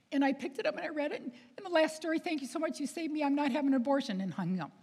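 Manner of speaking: high, emotional rush, imitated; tearful caller. Crying girl thanks author before hanging up, tearful imitation. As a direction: imitate her tumbling through it in a high voice. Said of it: "And she's crying".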